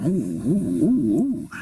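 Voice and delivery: wavering, swooping "ooh"s; mock-scared oohing. Friend making fun of a threat, mocking. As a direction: jeer at a threat with ghostly oohs.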